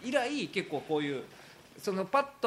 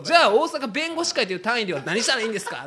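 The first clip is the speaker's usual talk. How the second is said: mock exasperated; 5 semitones higher, 8 dB louder than usual. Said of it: "ちょっと怒ってるやん".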